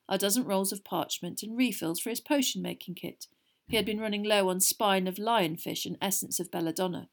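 ordinary delivery, nothing marked

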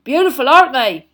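big booming hearty giant; a jolly loud gamekeeper; Hagrid voice. A narrator's reading. Hagrid admiring beasts.

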